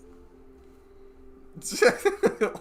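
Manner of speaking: high, loud burst of laughter. Real, not acted. Amused by request for an English accent.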